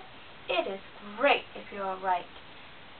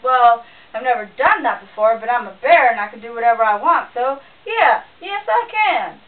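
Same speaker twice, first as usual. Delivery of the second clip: booming bear; 11 dB louder than usual. A narrator's reading. Bear bragging to himself, booming loud. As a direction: puff up and boom like a proud bear.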